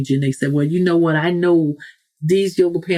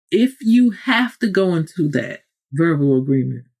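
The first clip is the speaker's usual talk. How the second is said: ordinary talk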